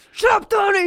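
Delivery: high, loud mock shout; hammed-up angry bellow; imitated yelling. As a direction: yell it as an impatient movie quote. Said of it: "Donnie always got yelled at".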